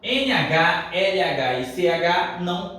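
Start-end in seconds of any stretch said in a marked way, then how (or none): none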